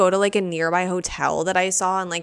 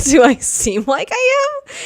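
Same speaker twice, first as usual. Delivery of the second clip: mock disbelief; 10 semitones higher, 8 dB louder than usual. Her incredulous reply, retold.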